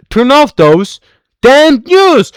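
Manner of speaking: loud, slow, furious order; slow furious command; angry shout. Acted. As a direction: bark it at the room. Mother snaps at family.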